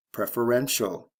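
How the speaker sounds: "preferential" stressed on REN; teacher's model of "preferential", beat on REN; stress on third syllable. Shown as a demonstration. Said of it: "first to third syllable".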